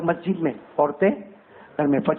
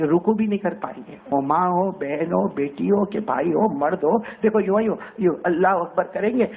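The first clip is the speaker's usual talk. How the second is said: ordinary talk, nothing marked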